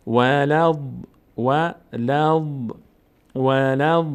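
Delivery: slow, held syllables closing on heavy ض; Quran recitation. Shown as a demonstration. Teacher joins lam to dad, slow recitation. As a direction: chant the joined syllable slowly and firmly.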